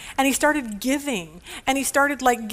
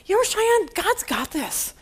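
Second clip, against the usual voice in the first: much higher and louder, breezy mom imitation; higher, louder, sing-song mom brush-off; mocking the upbeat mom; 7 semitones higher, 3 dB louder than usual. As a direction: parody a relentlessly cheerful mother. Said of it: "here's what my mom says".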